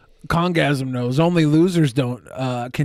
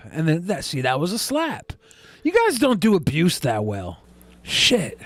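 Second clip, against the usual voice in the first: usual speaking voice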